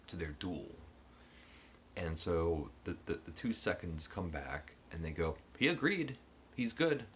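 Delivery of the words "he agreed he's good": much higher and louder, bright and eager; eager report. Retold or imitated. Seconds excitedly report the duel is on. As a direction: blurt it out like thrilled seconds.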